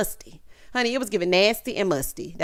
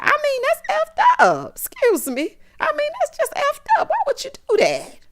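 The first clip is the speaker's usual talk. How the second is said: much higher, louder mock outrage; squeaky put-on scandalized mom voice; imitating Mama Joyce; 4 dB louder than usual. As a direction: play the offended mother, pitched way up. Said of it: "She's like".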